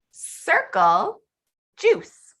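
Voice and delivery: teacher's slow, spaced-out command; teacher modeling. Shown as a demonstration. Teacher gives student a short instruction, slow modeling. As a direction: give a simple command, one word at a time.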